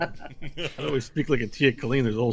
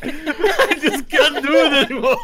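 high, loud real laughter breaking through the words; real helpless giggling; laughing; 9 dB louder than usual. Real, not acted. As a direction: give up on the accent, dissolving in laughter.

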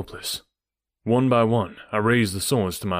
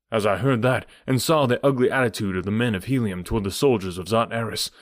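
plain reading voice

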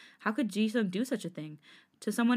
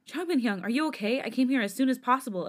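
sudden worried call; 5 semitones higher, 4 dB louder than usual. A narrator's reading. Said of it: "a sudden voice echoed into the room".

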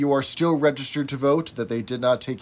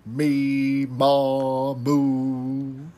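vocal warm-up; much slower than usual. Shown as a demonstration. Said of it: "vocal warm-ups".

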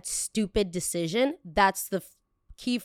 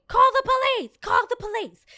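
much higher, louder dare to call the police; defiant yell; 7 dB louder than usual. Retold or imitated. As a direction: yell it back in her face. Retold shouting back at clerk.

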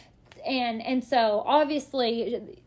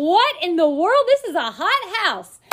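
louder, higher, awestruck gasp of delight; amazed exclaim; 5 semitones higher, 7 dB louder than usual. Retold or imitated. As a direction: blurt it out like seeing a dream house. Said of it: "I'm like".

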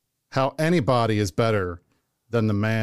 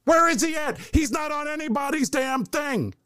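high, excited hype voice; excited high-pitched rant; 13 semitones higher than usual. Acted. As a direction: sell it like a hype man. Hyping his candidate, shouting.

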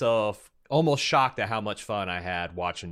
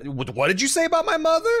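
very high, louder mock outrage; mock offended yell; 5 dB louder, faster than usual. Joking that trade talk turned to war, a mock offended yell. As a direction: squeal it like an insulted trader.

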